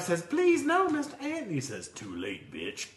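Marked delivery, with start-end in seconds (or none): 0.2-1.5 much higher, squeaky begging voice; pleading grasshopper. Acted. Grasshopper begging in a joke story. 1.9-2.9 much lower and softer, cold; cold ant voice. Acted. Ant answers in a joke story.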